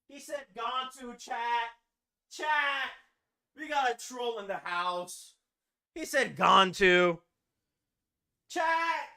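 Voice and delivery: high, drawn-out cry to the chat; mock outrage. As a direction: call out the troll in hammed-up disbelief.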